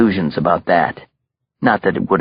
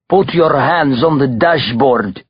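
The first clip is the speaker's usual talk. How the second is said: put-on higher-pitched, slow command in heavy Russian accent; Russian mobster's order; 6 semitones higher, slower than usual. A narrator's reading. Belova holds a gun to Terenzi's head, ordering. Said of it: "ordered Ivan Belova".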